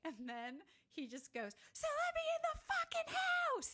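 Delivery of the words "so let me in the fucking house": high screaming yell of the villain; retold villain screaming to be let in; mock yell. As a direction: belt it out like a crazed intruder. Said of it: "He yells it".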